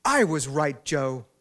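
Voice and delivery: loud call across a room; shouting across restaurant. Retold or imitated. Woman yelling to her husband across the restaurant, loud. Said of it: "screams across the restaurant".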